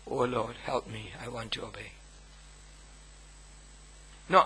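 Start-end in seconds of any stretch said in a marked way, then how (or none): 0.1-1.9 humble prayer. Retold or imitated. Model humble prayer voiced.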